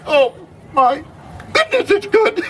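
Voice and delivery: high, laughing delight over stuffing; delighted laugh while dreaming of food; food rapture. Acted. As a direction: swoon with glee at the taste. Swooning over remembered food.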